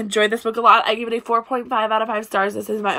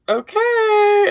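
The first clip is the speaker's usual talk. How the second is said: put-on humoring boyfriend; 12 semitones higher, 5 dB louder than usual. Retells boyfriend humoring her crying.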